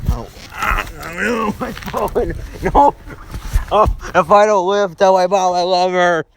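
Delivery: high, hammy dying cries; overacted last words of a dying soldier; wounded soldier gasping. Dramatic fake dying after phone falls.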